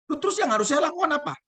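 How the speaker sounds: high, fast, startled wife; wife's voice. Acted. Wife asking the shaman what to do, high and startled. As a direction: squeak it out as the puzzled wife.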